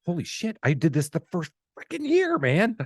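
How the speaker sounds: high-pitched, exasperated self-quote; retold exasperation. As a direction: act out stunned disbelief at yourself. Retelling his own exasperated reaction.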